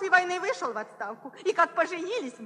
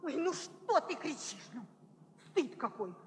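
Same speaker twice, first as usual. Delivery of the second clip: hushed scolding; 8 semitones lower, 9 dB quieter, faster than usual. Acted. Wife hushes husband's shouting.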